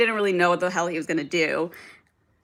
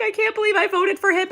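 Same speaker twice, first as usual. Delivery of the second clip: mocking high whiny voice, much higher; mock regretful voter; 12 semitones higher than usual. Mocking voters who now regret it, in a high whiny voice. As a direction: parody a voter crying regret, voice pitched way up. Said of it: "the people who are like".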